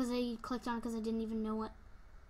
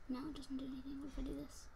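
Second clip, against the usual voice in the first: whisper; 10 dB quieter, faster than usual. Real, not acted. Quiet muttering to himself.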